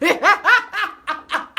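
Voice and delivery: high, loud peal of laughter; hearty laugh. Real, not acted. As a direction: crack up at your own words. Laughs after out of breath.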